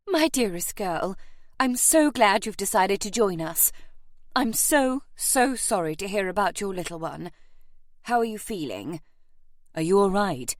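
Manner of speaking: lightly voiced effusive great lady; affectionate aunt. A narrator's reading. Aunt consoling her nephew's bride, effusively sympathetic. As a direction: fuss over her with sympathy.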